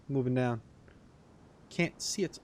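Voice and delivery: usual speaking voice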